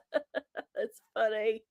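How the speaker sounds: slow, said through a laugh; amused reply to a chat joke. Real, not acted. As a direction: chuckle the words out.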